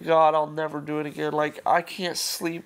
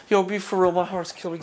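normal talking voice

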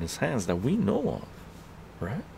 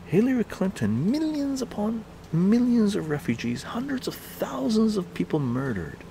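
high-pitched, worked-up rant; incredulous outrage; 10 semitones higher than usual. Real, not acted. Arguing nobody sane would choose her, incredulous. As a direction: ask it in exasperated disbelief, pitch climbing.

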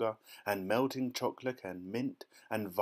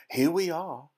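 high, loud, gleeful announcement; Mister Wonka, crying out; 7 semitones higher, 8 dB louder than usual. A narrator's reading. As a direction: call it out brightly to the group. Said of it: "cried Mister Wonka".

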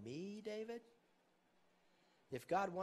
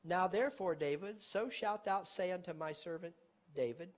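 scripture reading; 3 semitones higher, 5 dB louder than usual. Acted. Reading scripture aloud with force.

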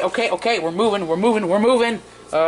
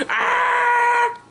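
very high, drawn-out wail; mock falling scream; 3 dB louder than usual. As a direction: scream as if going overboard. Figure thrown overboard.

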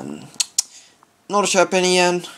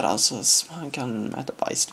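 ordinary talk, nothing marked

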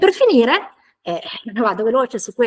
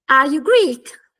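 "Are you Greek?" with pitch arching up high on Greek; slow teacher's model question, voice climbing on "Greek"; umbrella intonation; 3 semitones higher, 5 dB louder, much slower than usual. Shown as a demonstration. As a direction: lift "Greek" like an opening umbrella, loud and drawn out. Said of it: "Mi si alza l'ombrello?".

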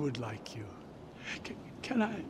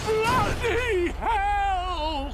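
shout; 9 semitones higher, much slower than usual. Real, not acted. Wild car chase.

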